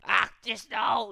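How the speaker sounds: shrieking high and loud, slow; a frightened, angry shriek; cried out. A narrator's reading. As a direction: cry out in alarm. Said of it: "he cried".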